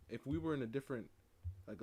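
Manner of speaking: normal talking voice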